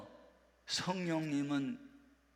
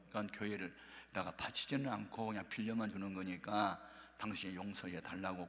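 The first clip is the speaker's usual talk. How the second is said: soft, pleading confession; 5 semitones lower, 7 dB quieter than usual. Retold or imitated. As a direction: lower and softer, begging gently. Wife kneeling, begging husband.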